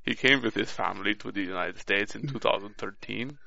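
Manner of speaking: lightly put-on Russian accent, drifting toward Kissinger; half-hearted Russian accent reading a bio. Shown as a demonstration. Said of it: "are you doing Russian or Kissinger".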